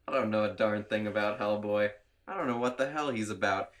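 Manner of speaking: ordinary delivery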